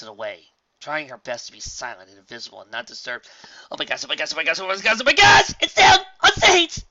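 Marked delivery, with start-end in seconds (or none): 3.7-6.8 rapid gushing building to a very high, loud squeal; fangirl squeal. Acted. Fan spotting her idols on stage, squealing excitement. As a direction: start contained, explode into an ecstatic shriek.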